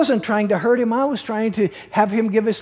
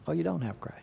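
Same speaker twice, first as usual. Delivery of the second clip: whisper; 2 semitones lower, 11 dB quieter, faster than usual. Real, not acted. Preacher pressing the congregation, whispered. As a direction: breathe the end in a whisper.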